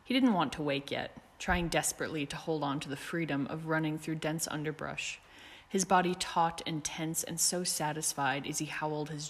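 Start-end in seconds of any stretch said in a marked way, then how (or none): none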